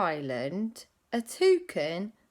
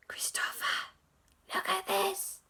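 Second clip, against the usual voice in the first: hushed call; 13 semitones higher, 6 dB quieter than usual. Acted. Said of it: "peered out the window and went".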